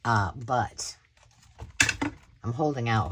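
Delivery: ordinary talk, nothing marked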